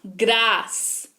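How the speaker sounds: ग्रास drawn out slowly with a clear ग्र cluster; slow clear pronunciation. Shown as a demonstration. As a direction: stretch out ग्रास so students hear ग्र. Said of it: "यानी कि ग्र". (ग्रास धीरे, साफ़ ग्र के साथ)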